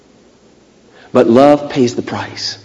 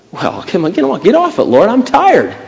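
high-pitched pleading whine, acted; mock exasperated plea; protesting tiredness; 6 semitones higher than usual. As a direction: beg off like a worn-out complainer. Acted weary protest to God.

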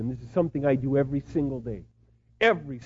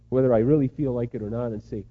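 ordinary delivery

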